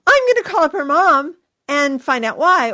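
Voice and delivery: mock indignant parent, loud and quick; outraged mom. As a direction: play the indignant mom ranting about the party. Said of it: "I hear from moms".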